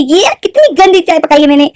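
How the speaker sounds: high, loud disgusted cry; disgusted son. Retold or imitated. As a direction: spit it out and groan at the taste. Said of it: "बोलता है".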